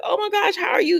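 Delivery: high, shrieking disbelief; shocked surprise. Retold or imitated. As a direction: gasp it out like she's seen a ghost. Ava shocked to see Jake.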